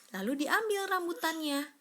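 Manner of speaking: ordinary delivery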